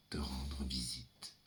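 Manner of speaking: slow breathy whisper, no voice; slow unvoiced whisper. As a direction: whisper it like a secret vow. Hushed promise to the father.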